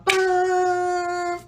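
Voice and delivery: a short, sharp "Bum!" copying a car horn; horn imitation. Narrator imitating the car horn behind them. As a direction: blurt it like a horn blast.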